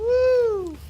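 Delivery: very high, loud celebratory "woo"; excited whoop. Real, not acted. Player celebrating six hit points, real excited whoop.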